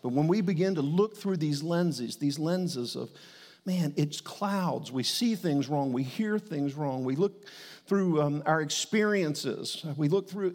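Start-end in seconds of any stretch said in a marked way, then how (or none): none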